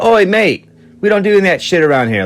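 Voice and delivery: put-on high, fast English accent; a cockney "oi mate" warning; hammed-up English roadie telling him off. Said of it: "he's like".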